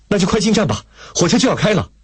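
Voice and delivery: high-pitched, pressing instruction; urgent urging. A narrator's reading. Lei Feng urging the woman to board, urgent urging. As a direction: hurry someone along. Urging her to catch train.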